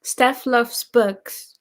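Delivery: "Steph Loves Books" drawn out word by word; slow clear repeat. Real, not acted. Repeating a channel name after "Who?", slow and clear.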